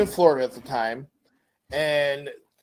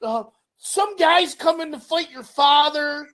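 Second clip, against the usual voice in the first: high-pitched flustered mom imitation; panicked mom; 11 semitones higher than usual. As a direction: mom rushing her son off the phone. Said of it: "she's like".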